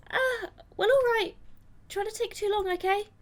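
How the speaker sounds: high, put-on girlish voice; girl character. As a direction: pitch it right up for the girl's reply. Voicing girl in visual novel.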